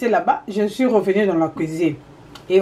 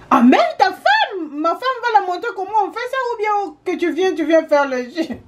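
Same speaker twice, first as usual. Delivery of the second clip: husband's retort; 7 semitones higher than usual. Retold or imitated.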